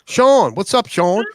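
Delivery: high, loud hello to a friend; excited greeting. Real, not acted. Welcoming a friend who just joined, excited call. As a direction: call out his name with a lift.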